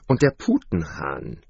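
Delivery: plain reading voice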